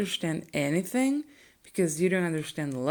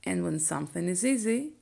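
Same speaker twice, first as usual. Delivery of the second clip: normal talking voice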